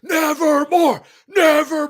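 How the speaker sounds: high, loud, slow dramatic wail; mock scream. Pretending to scream Poe at Baltimore, slow mock wail. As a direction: wail it like Poe's raven. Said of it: "we're gonna all be screaming".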